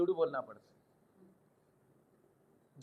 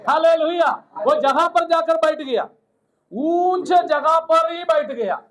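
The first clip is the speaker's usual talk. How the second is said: raised, louder preaching voice; full-force preaching; 5 semitones higher, 5 dB louder than usual. Real, not acted. Preacher telling the congregation of Noah's ark set high, preaching forcefully. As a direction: proclaim it high and loud to the congregation.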